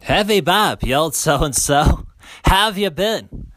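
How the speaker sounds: normal talking voice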